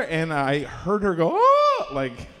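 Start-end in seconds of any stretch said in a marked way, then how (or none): 1.3-1.9 much higher, louder yelp; sudden high frightened yelp; startled scream. Retold or imitated. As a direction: shriek in fright. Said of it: "kinda scream".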